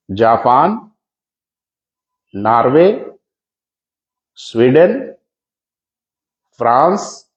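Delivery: each country name alone with long pauses for writing; slow dictation. Shown as a demonstration. Teacher slows down so students can note each country. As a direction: spell out the list slowly so students can write. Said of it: "तो मैं आराम से बोल रहा हूं".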